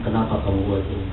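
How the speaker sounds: low, soft, gentle questioning; loving reproach. Retold or imitated. Mentor asking a fallen mentee why, soft loving reproach. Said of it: "Dengan kasih saya menangis, saya bilang".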